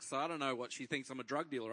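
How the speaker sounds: normal talking voice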